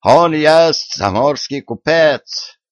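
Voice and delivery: hoch und langsam, mit deutschem Akzent gebrochen; deutscher Akzent. Acted. German Willy speaks broken Russian.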